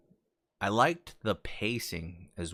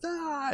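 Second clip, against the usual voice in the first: much higher sharp imitated yell; a short hammed-up cry of despair; anguished yell. Peter as Aunt May dies, imitated anguished yell. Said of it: "and just yells".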